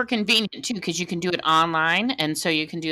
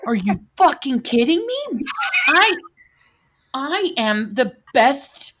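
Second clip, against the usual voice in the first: raised, higher mock outrage at her daughter; inner outrage; 6 semitones higher, 4 dB louder, slower than usual. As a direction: blurt out the hurt mother's indignation, half joking. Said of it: "And I was just like".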